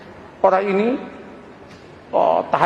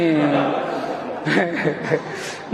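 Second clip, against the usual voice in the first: low laugh; 5 semitones lower, 5 dB quieter than usual. Real, not acted. Preacher laughs at own joke.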